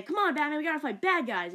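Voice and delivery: lightly voiced eager young Robin; eager kid voice. Kid Robin quoted in a retelling.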